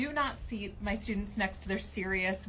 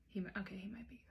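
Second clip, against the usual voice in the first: voiceless whisper, much softer; 12 dB quieter, faster than usual. Acted. Teacher conceding the weed gossip to a colleague, hushed whisper. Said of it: "confiding whisper".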